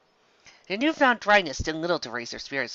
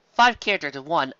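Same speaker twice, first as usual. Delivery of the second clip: ordinary delivery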